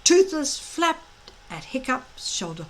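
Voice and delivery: ordinary delivery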